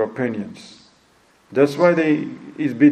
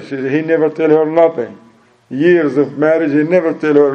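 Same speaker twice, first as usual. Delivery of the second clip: ordinary talk, nothing marked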